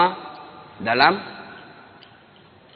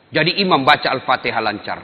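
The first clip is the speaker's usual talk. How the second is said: usual speaking voice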